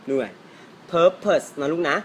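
ordinary delivery

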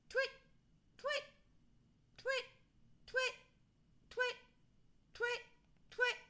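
repeated short high hoots; insistent little owl calls; owl twitting. A narrator's reading. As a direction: hoot over and over, spaced out. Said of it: "carried on twitting over and over again".

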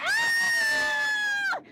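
loud high shriek; startled scream. Real, not acted. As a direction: scream at the sudden scare. Jump scare in haunted maze.

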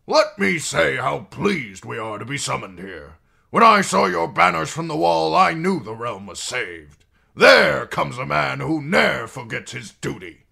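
high, loud, oily flattery; fawning courtier. Acted. Janos flatters the king.

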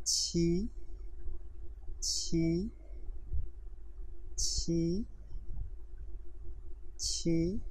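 soft aspirated "छी" with a puff of breath; teacher drilling the aspirated chi; sound demo. Shown as a demonstration. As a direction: breathe out "छी" softly, each on its own. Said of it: "अगला sound".

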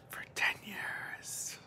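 slow, drawn-out whisper; whispered dismay. Real, not acted. Vlogger admitting she still carries debt, whispering slowly. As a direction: let it sink to a slow whisper.

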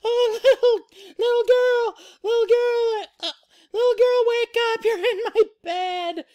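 very high, loud cub squeal; Baby Bear. Acted. Baby Bear waking the sleeper, high urgent squeal. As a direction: squeak it insistently, over and over. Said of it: "and then Baby Bear".

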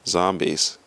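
ordinary talk, nothing marked

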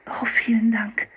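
put-on high girlish thanks; grateful girl. A narrator's reading. Girl receiving fur trousers from the hunter, high thanks. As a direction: thank him in a high-pitched girl's voice. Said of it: "sagte Anne".

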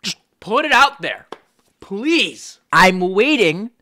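loud, high, insistent pleading; mock demanding. YouTuber daring a fan to explain their imaginings, loud mock demand. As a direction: press them loudly to spill it.

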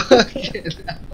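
ordinary talk

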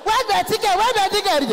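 high-pitched, loud demand; mock shout. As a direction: shout it high, rapid-fire. Mock shouting at an audience member.

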